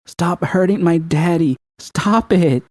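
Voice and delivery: put-on high tearful child's voice; high sobbing child yelling; crying little girl. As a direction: plead like a small girl in tears. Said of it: "sobbing over the unconscious man".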